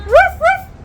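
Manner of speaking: loud, high yelping bark; dog bark. Acted. Thief forced to bark as her apology, loud high yap. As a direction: yap it out like a scolded puppy. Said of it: "bark like a dog".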